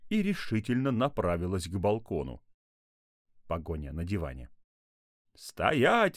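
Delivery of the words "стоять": loud, high command to halt; shouting. A narrator's reading. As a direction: yell after a runaway. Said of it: "закричал папа".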